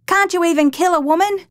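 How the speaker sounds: high, sneering shouted taunt; scornful yell. A narrator's reading. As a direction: yell the mockery at him. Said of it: "the blonde man yelled".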